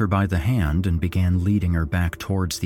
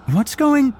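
high, loud question cut short; alarmed; 6 dB louder than usual. A narrator's reading. Friend runs up and sees the body, alarmed. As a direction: start asking in alarm, break off abruptly. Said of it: "She cut off abruptly".

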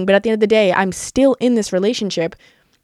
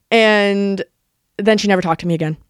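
ordinary talk

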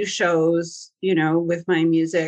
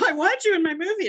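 much higher, eager voice of the producer; 11 semitones higher than usual. Retold or imitated. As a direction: invite her like a keen producer. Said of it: "And so she said".

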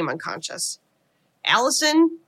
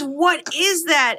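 higher, drawn-out mock horror; retold horrified question; 7 semitones higher, much slower than usual. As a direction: act out appalled disbelief, stretching the words. Acting out horrified husband's question.

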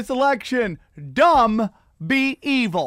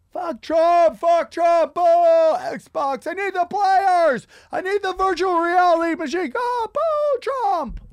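high, loud mock chant of a greedy rioter; mock protester shouting for an Xbox; rowdy looter protester; 9 semitones higher, 4 dB louder, slower than usual. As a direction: ham up a looter yelling slogans while grabbing stuff. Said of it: "I was like".

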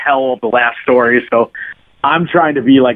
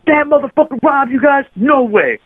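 much higher, shocked and indignant; can't believe he'd dare; outraged disbelief; 12 semitones higher than usual. Retold or imitated. The street kids hearing the first guy robbed the tourists, shocked and indignant.

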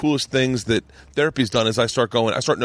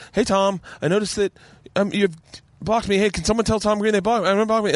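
mock pleading tweet; 8 semitones higher than usual. Reading out his tweets to Tom.

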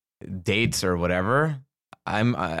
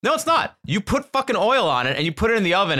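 high, exasperated rant; 9 semitones higher, faster than usual. Real, not acted. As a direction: protest it in disbelief.

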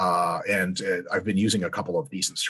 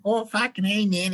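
high-pitched mock Australian accent; 12 semitones higher than usual. Podcaster mimicking how the Australian actor really talks, mock Australian accent. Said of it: "he talks like that".

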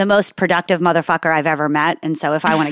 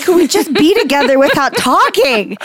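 high-pitched, mock-frustrated begging; comically fed up; exasperated plea; 8 semitones higher, 4 dB louder than usual. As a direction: act out pleading with a chatty husband. Acted plea for quiet.

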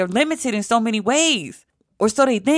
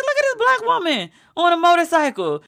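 higher, thrilled pointing-out voice; excited exclaim; 6 semitones higher than usual. Retold or imitated. Telling viewers about the biker on the show, thrilled exclaim. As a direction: call it out like showing a friend something amazing. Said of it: "I was like".

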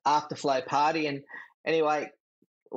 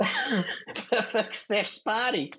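laughing; 6 semitones higher, much slower than usual. Real, not acted. Laughing at own story.

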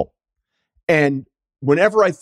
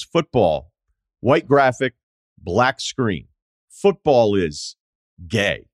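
slow deadpan ad read; 3 semitones lower, much slower than usual. Retold or imitated. Reading out the ad text.

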